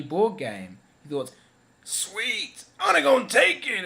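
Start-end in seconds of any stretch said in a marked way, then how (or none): 1.8-3.8 high-pitched, quick gleeful voice; acted excitement. Voicing Alan finding the board game, hammed-up excitement. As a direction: squeal it like a kid who found treasure. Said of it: "is what he was thinking".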